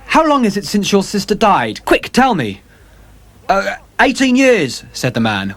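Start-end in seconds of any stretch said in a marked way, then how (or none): 0.1-2.6 high, urgent demand. Acted. Dorian's desperate hope. 3.5-4.8 puzzled sailor. Acted. James Vane answers.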